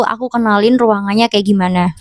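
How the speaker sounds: usual speaking voice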